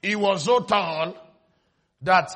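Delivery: ordinary talk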